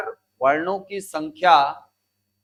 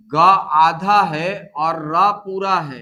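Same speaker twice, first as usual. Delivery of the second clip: slow explaining; 4 dB louder, much slower than usual. Shown as a demonstration. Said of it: "ध्यान देना".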